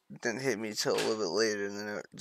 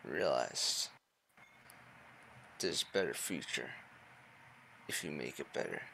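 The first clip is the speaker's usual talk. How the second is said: dramatic monologue; 4 semitones lower, 5 dB louder than usual. Pretend. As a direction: recite it like a solemn confession. Mock-profound monologue.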